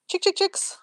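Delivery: high sing-song call to the hens; calling chickens. Real, not acted.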